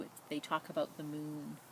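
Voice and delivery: ordinary delivery, nothing marked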